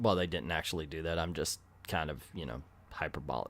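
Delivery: very low, soft, hurried retraction; quiet, rapid low-pitched disclaimer; sheepish aside. Real, not acted. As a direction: back off quickly under your breath. Sheepish retraction of own joke.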